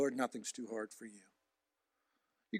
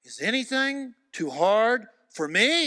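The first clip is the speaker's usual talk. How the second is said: slow, loud, high question as God; God challenging Moses, slow booming question; 9 semitones higher, 8 dB louder, slower than usual. Retold or imitated. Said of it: "God says".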